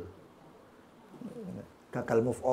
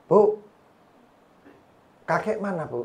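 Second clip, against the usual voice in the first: lightly voiced child calling mother; higher-pitched, imitated little kid's question; small child; 4 semitones higher than usual. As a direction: ask mum like a curious little one. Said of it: "anak kecil ditanya".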